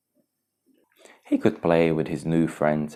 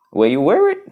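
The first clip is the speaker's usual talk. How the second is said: character voice; 13 semitones higher, 8 dB louder, faster than usual. Acted. Simba asks friends in story.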